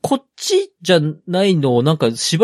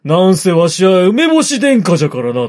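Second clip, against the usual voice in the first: put-on louder old lord voice; creaky wise old man; 6 dB louder than usual. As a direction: boast like an old prince. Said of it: "もち殿下がこうおっしゃいまして。".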